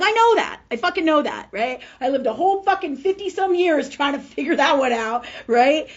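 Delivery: high, loud retold outburst; exasperated outburst. Answering the healer about an unpleasable mother, exasperated shouting. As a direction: burst out at full voice, fed up.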